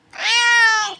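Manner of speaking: a high, loud meowing "why"; mock cat meow. Owner mimicking his cat's meow, high and loud. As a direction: stretch the word into a cat's meow.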